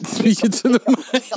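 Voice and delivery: high, put-on "Speaking to the mic"; playful high voice for a mic test; high mic check. Testing the microphone, playful repeat.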